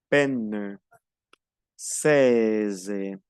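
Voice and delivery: penne and seize with long open "eh" vowels; elongated open E. Shown as a demonstration. Teacher models the longer E, drawn-out "penne", "seize". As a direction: stretch each word out, apart, voice sinking. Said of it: "es un poco más alargada".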